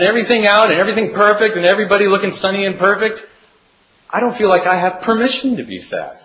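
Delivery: lightly voiced Nora, wistful and pleading; pleading friend. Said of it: "She said".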